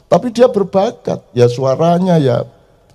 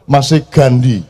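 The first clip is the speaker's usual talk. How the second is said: ordinary delivery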